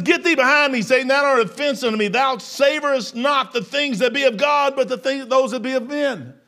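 high, loud scripture rebuke; stern preached rebuke; preached scripture quote. Acted. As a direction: proclaim the verse with force. Jesus quoted in King James, raised.

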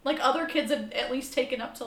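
ordinary talk

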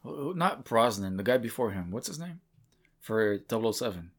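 ordinary delivery

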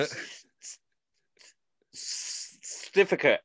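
stuttered hissing s before "certificate"; "certificate" squashed to "stificate" after false starts; stumbling over the word. Retold or imitated. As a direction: stick on the s, then blurt "stificate". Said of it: "And I go".

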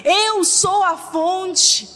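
urgent, declamatory preacher; fervent preaching. Real, not acted. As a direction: preach it with force to a congregation. Preaches to congregation.

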